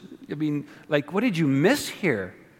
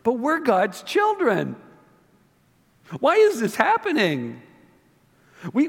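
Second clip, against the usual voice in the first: indignant Israelites; 8 semitones higher than usual. Retold or imitated. Israelites protesting to God, high whiny indignation. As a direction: play the offended chosen people. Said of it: "Back then it was".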